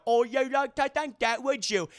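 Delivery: high, loud, hammed-up British accent; mock British accent. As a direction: answer back in an exaggerated British voice. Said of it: "starts talking with a British accent".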